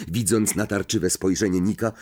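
usual speaking voice

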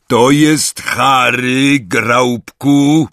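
high, loud, stretched words; slow loud introduction. A narrator's reading. Hagrid introducing Harry to the giant, slow and loud.

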